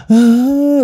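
high mock wail; complaining cry. Survivor showing how he cried in the water, mock whining wail. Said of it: "nangis mengeluh".

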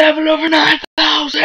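loud, high hammed-up yell; meme shout. Dragon Ball meme shout.

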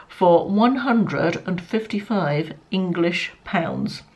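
slow, weighted "one hundred and fifty-five English pounds"; stressing the price. Real, not acted. Vlogger spelling out a pricey lip serum's cost, slow and weighted.

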